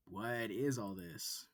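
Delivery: slow, weighed words; slow heavy question. Acted. Student repeating the question to the wolf, slow and drained. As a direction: let each word drop.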